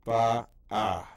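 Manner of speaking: ba'a said loud and clear; Hebrew reading demo. Shown as a demonstration. Teacher reads last word ba'a, clear model. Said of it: "the last word on this chart".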